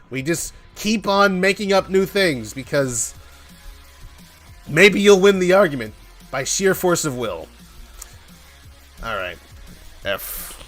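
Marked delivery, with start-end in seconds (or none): none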